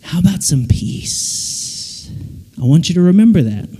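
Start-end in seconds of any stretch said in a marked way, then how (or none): 0.0-2.1 slow, hushed, breath-filled voice; God's gentle offer. Acted. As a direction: offer it softly, stretching the last word. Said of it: "God is there waiting, saying".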